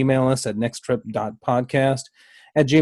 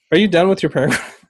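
usual speaking voice

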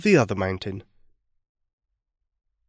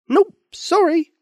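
high dragon voice; 4 dB louder, slower than usual. Acted. Dragon answers Thomas.

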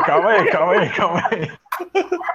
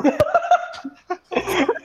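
burst of laughter. Real, not acted. Friends laughing at the mix-up.

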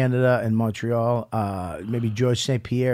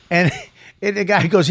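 ordinary talk

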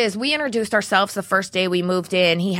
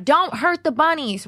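mock yell; 9 semitones higher, 3 dB louder than usual. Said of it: "So I yelled across the street".

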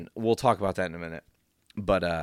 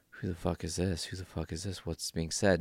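confused mutter; 2 semitones lower, 8 dB quieter than usual. Retold or imitated. Audience confused by hologram.

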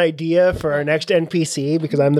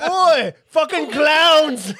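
put-on English accent shout; 9 semitones higher, much slower than usual. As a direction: yell like a rowdy London fan. Mock English hecklers shouting.

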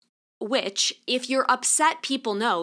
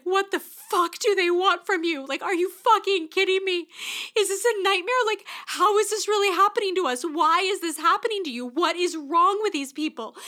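retold angry outburst; 9 semitones higher than usual. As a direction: reenact the husband's outraged yelling. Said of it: "He was so angry".

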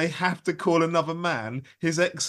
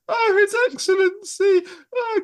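much higher hammed-up falsetto "His Excellency"; woman's voice; 6 dB louder than usual. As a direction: squeal "His Excellency" like a mock lady. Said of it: "doing it in a woman's voice".

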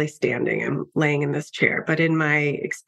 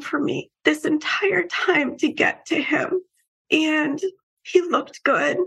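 high, tearful voice close to breaking; real emotion welling up as she pictures him; choked up; 8 semitones higher than usual. Real, not acted. Telling of meeting her late brother again, voice choked with tears.

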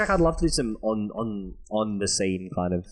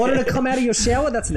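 squeaky mock voice; 13 semitones higher, 4 dB louder than usual. Acted ghost host quote.

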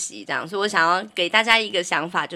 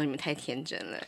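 quiet inner thought; 5 semitones lower, 13 dB quieter, faster than usual. Retold or imitated. Inner thought, dropped to hush.

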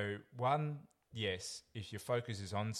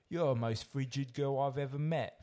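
put-on old london cockney; 6 semitones higher, 4 dB louder than usual. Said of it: "Essex lad".

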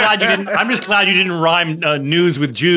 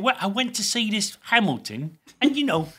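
Michael Caine; 7 semitones higher, slower than usual. Acted. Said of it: "Michael Caine's impression of Hamilton".

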